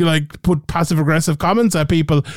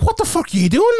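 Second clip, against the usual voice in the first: much higher, disbelieving cry; incredulous outburst; 12 semitones higher than usual. Retold or imitated. Host reacting to USADA's overnight statement, with a high incredulous outburst. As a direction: throw up your hands in disbelief. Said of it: "I'm like".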